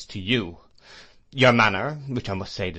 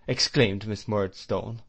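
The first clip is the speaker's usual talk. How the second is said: plain reading voice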